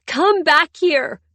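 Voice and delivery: high, loud, slow scream; shrill scream. A narrator's reading. Wife screaming at husband leaving, shrill scream. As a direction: scream after him in tears. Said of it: "I scream, my voice shrill and ugly".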